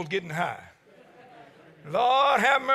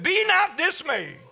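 preaching shout; 6 semitones higher, slower than usual. Retold or imitated. Preacher to congregation, quoting the old deacon, shouting. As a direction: proclaim the deacon's words with full fire. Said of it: "Deacon J E McDaniel said".

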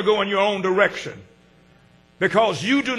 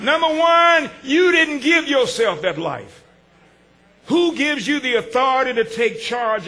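preaching shout; 4 semitones higher than usual. Acted. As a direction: lift it up to the rafters. Preaching at full force.

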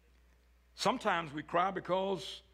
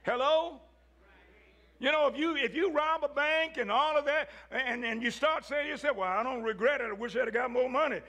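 preaching build; 7 semitones higher than usual. Acted. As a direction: climb toward the pulpit peak. Preaching at higher intensity.

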